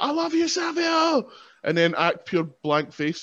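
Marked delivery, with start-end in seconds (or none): 0.0-1.3 fan shout. Pretend. Fan interrupting the seminar with a high shout. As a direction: yell it like a starstruck fan. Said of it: "just be like".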